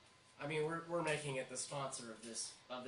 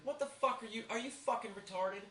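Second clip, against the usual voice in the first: retold scream at recruits; 8 semitones higher than usual. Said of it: "scream at 'em".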